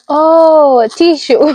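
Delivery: loud, high clarifying "oh"; animated retold correction; acted-out realisation. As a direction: raise pitch and volume as it clicks. Acts out explaining the word.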